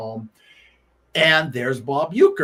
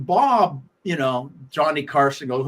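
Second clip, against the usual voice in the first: normal talking voice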